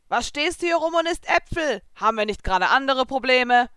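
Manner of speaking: a lightly voiced boy scolding; Natsu scolding. A narrator's reading. Natsu scolding Lucy for eating apples, mildly.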